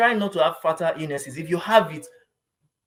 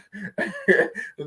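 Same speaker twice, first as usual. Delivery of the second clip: higher-pitched giggle; real chuckle cutting off the sentence; laughing; 4 semitones higher than usual. Real, not acted. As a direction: giggle before the last word. Laughs again before finishing joke.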